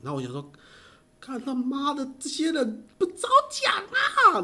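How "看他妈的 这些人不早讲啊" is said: much higher, drawn-out slow cry of disbelief; exasperated outburst. Retold or imitated. Retelling his shock that nobody told him sooner, exasperated and high. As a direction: throw up your hands that nobody told you.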